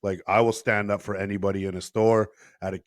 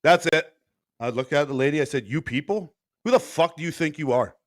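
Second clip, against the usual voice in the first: high, louder confrontation; retold anger; 5 semitones higher, 3 dB louder than usual. Man confronting rude customer in Subway, angry and louder. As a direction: call her out hard.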